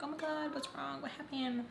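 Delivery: high, soft fussing voice; imitated worried woman. Woman at home seeing her crying, imitated worried questions. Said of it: "don't want her to be like".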